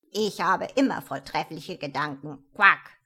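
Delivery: loud jolly frog, ending in a loud croak; a fat frog teasing a friend. A narrator's reading. Frog voiced in story.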